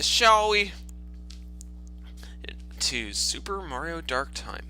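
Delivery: normal talking voice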